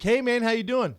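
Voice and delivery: loud, high, chummy greeting; super friendly. Retold or imitated. Big seatmate greeting the comic, super friendly. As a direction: greet him like an overeager buddy. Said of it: "Super friendly".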